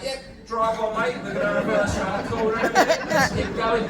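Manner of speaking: loud, fast mock examiner, breezy; driving examiner. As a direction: cheerfully wave the learner on. Driving examiner calmly waving on.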